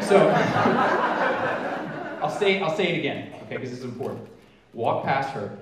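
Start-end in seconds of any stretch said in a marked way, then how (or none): 0.3-2.1 sudden high unvoiced laugh; amused laugh; burst of laughter. Real, not acted. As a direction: break into a real laugh at yourself. Laughs at own aside.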